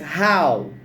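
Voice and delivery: "How" said singly and drawn out; repeat-after-me model. Shown as a demonstration. Teacher asks class to repeat, "How" as clear model. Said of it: "Let's repeat".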